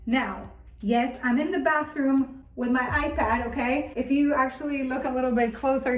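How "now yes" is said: usual speaking voice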